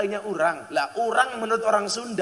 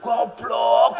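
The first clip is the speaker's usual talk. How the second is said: louder, higher mock self-scolding; 6 semitones higher, 7 dB louder than usual. Real, not acted. Preacher scolding himself for wrong Javanese. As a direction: scold yourself playfully. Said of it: "goblok ya aku".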